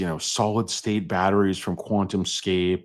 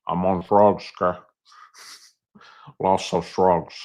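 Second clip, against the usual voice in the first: thick, put-on mobster accent; Pacino impression. [name] excusing himself to his wife, thick Pacino impression. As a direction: slur it like a movie gangster. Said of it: "just go full Pacino".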